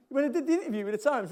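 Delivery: ordinary talk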